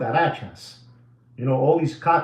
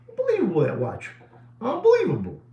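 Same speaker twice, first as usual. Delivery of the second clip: Unbelievable jumping much higher; mock amazement; 6 semitones higher, faster than usual. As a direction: exclaim Unbelievable in mock disbelief. Marvels at the watch.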